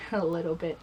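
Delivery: whispered, self-mocking aside; sarcastic aside. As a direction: undercut yourself in a breathy hush.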